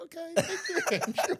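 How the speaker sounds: high, slow, laughing thanks; amused politeness. Retold or imitated. Lady humoring the confident customer, amused. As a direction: imitate a smiling, puzzled thank-you through laughter. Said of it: "kinda just look at him and she smiled".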